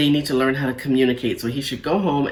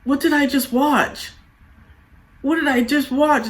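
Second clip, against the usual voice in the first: much higher, aghast question; pitched-up stunned disbelief; 10 semitones higher than usual. Retold or imitated. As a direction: sound baffled by what you saw. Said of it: "And I'm just like".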